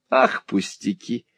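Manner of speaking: slow, giggly brush-off; giggling. A narrator's reading. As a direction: laugh the reproach away. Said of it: "хихикая".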